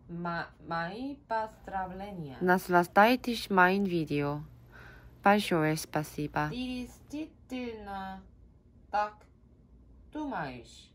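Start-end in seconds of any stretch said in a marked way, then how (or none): none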